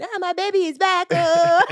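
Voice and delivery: high, loud gushing delight; mother welcoming her daughter home, overjoyed. Retold or imitated. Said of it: "was over the moon".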